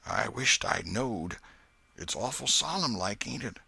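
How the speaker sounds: put-on hushed, spooked country boy; whispered reply. A narrator's reading. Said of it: "Huckleberry whispered".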